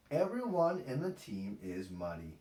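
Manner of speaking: ordinary delivery, nothing marked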